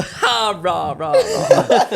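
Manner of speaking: imitated laugh, leaping high in pitch; exaggerated fake guffaw in imitation of someone; mock laugh. Mimicking how Danny laughs for the lads, mock laugh. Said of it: "does Danny only laugh? You go".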